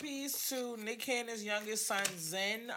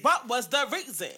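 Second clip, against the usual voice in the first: a louder, lightly done [name] demanding the reason; 7 dB louder than usual. Retold or imitated. Podcast host mimics [name] asking about [name], light impression. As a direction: toss off a quick, half-hearted [name] question. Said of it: "the reason [name] voice".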